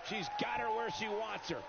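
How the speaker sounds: high, excited play-by-play call; wrestling commentator. Acted. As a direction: hype it like a ringside announcer. Game commentator voice holds calls.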